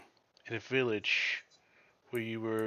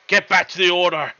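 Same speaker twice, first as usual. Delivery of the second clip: loud, raised-pitch battle command; urgent shouted order; 8 semitones higher, 16 dB louder than usual. Acted. Knight rescuing someone from dragonborn, shouting an urgent order. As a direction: shout the order over the fighting. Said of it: "you see Sir Lewis and says".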